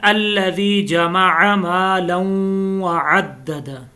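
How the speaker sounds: slow chanted tajweed reading; measured Quranic chant; slow recitation. Shown as a demonstration. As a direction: recite the verse slowly and evenly. Recites the second verse.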